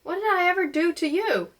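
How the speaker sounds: high, loud, tearful plea; a hurt cry of disbelief, pitched high and loud; starting to cry. A narrator's reading. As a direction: voice cracking upward as tears start. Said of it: "I said, starting to cry".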